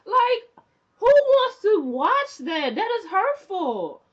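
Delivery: high, loud, outraged, slow. Real, not acted. Viewer outraged at the king's cruelty, high and loud. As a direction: protest like it personally hurt you.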